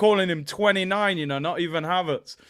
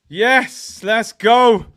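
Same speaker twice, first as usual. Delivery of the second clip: ordinary talk, nothing marked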